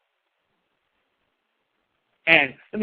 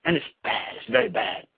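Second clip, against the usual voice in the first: hushed; 4 semitones lower, 7 dB quieter than usual. Acted. Hushed warning to congregation.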